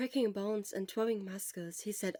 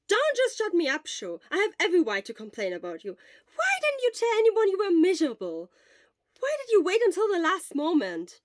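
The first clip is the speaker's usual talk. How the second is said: loud, high shaken scolding; upset scolding; 9 semitones higher, 10 dB louder than usual. Acted. Friend loudly scolding Sho after his heart stopped. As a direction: berate him, still rattled.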